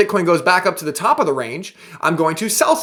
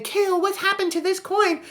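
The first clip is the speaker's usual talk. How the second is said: much higher, softer plaintive squeak; mock worried viewer; 12 semitones higher, 5 dB quieter than usual. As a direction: imitate a fretting holder. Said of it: "if you are sitting here saying".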